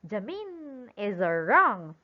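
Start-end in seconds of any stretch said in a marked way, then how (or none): 0.1-0.9 Jamin with the I held long; quick first syllable, drawn-out MIN in Jamin; stress on second syllable. Shown as a demonstration. Teacher warning what will happen, second syllable stressed in Jamin. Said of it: "if you put the stress on letter I".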